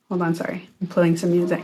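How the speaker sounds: low, soft apology; quiet aside. Real, not acted. Speaker pausing the talk to play music, quiet aside. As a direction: drop the voice while attending to something.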